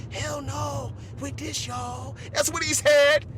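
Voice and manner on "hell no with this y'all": quiet Joe. Retold or imitated.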